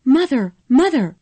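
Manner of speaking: running up the steps, calling for mother; crying out. A narrator's reading. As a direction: call out for her mother.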